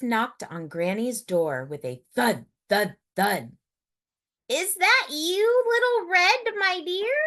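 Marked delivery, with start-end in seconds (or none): 4.5-7.2 put-on high, sweet old-lady voice; sweet Granny. A narrator's reading. Granny calling through the door, high and sweet. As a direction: call out high and kindly. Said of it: "called Granny".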